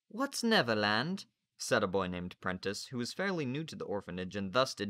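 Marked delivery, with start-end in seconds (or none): 0.1-1.3 put-on curious boy. Narrator voices boy Prentice.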